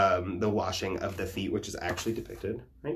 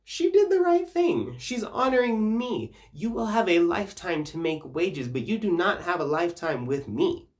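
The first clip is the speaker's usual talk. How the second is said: much higher, louder exasperated snap; exasperated Jesus; 10 semitones higher, 3 dB louder than usual. Retold or imitated. Jesus rebuking the apostles for Bethany, exasperated. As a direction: play a fed-up Jesus telling them off. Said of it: "he turns around to these motherfuckers and is like".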